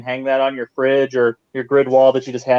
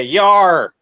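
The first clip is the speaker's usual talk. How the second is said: pirate voice; 3 semitones higher, 4 dB louder than usual. Pretend. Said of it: "Talk like a pirate day".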